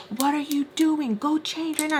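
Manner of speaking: high-pitched exasperated order; retold scolding. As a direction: imitate a fed-up parent giving orders. Mister Peach ordering girl, retold.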